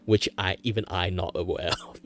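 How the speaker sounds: normal talking voice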